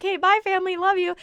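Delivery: high, loud and cheery; chirpy goodbye. Retold or imitated. Waving off family, high chirpy farewell. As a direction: toss it off bright and high.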